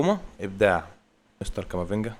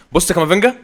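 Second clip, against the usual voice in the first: higher and louder call to look; excited shout; 7 semitones higher, 8 dB louder than usual. Real, not acted. Gamer calling viewers to watch his player, excited shout. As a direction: grab the viewers' eyes with his name.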